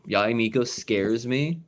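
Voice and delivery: ordinary talk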